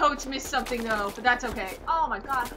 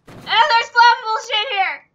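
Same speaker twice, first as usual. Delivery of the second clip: high, loud, slow cry of alarm; alarmed yell; 10 semitones higher, 13 dB louder, slower than usual. Real, not acted. Player spotting flammable stuff nearby, alarmed yell. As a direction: yell out the danger, panicking.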